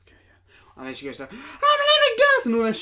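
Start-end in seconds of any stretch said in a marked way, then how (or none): 0.1-0.7 tiny whispered shy reply; shy mumble. Retold or imitated. Co-host imitating the shy, unconfident film character, whispering. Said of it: "shy and, like". 1.3-2.8 mock outburst. Co-host mocking the character's sudden change, screaming. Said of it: "She goes from being, like, really, like, shy".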